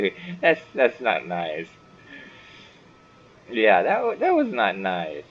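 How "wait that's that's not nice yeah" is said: usual speaking voice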